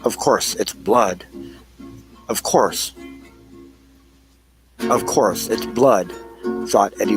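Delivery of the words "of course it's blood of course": ordinary talk, nothing marked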